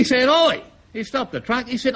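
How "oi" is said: sharp high call of Oi; hammed-up boss hollering; mock shout. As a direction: yell at the driver to stop. Boss yelling at truck driver.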